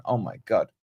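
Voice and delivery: normal talking voice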